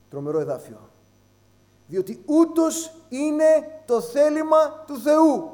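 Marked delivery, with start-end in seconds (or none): 1.9-5.5 slow, higher and louder, every word weighed; solemn scripture reading. Acted. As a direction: proclaim the verse like a sacred text. (επίσημη, αργή ανάγνωση εδαφίου)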